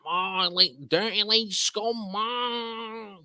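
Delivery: hammed-up Mancunian, high and drawn out; Mancunian accent. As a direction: mimic a rowdy Manc, high, stretching the words. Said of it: "that is what you call a Mancunian accent".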